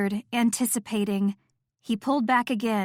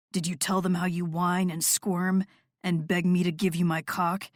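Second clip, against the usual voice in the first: lightly lowered male voice, teasing; a hint of a commanding man; dominant lover; 3 semitones lower than usual. A narrator's reading.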